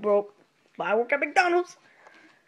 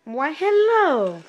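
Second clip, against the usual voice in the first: slow, drawn-out hello in an odd voice; old style greeting; 5 semitones higher, 4 dB louder, much slower than usual. Acted. Shopkeeper trying the old style greeting, slow. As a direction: stretch the greeting out slowly.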